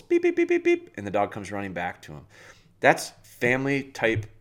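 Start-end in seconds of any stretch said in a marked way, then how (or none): 0.0-0.9 quick, very high-pitched whistle pips; whistle blasts. Retold or imitated. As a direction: imitate a dog owner's recall whistle. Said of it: "the owner just whistles".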